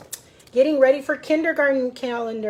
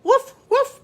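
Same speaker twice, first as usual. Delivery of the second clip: high, loud little woofs; dog bark; 13 semitones higher, 4 dB louder than usual. A narrator's reading. Titus the farm dog barking at the bus, high woofs. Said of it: "barked Titus".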